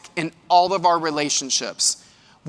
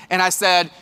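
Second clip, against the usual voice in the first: normal talking voice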